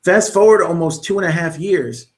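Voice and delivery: usual speaking voice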